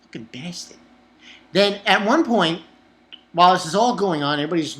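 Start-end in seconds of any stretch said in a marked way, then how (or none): 0.0-0.8 much softer, low muttered curse; soft, low muttered fucking bastard. Retold or imitated. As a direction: grumble it under your breath. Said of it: "And I'm like".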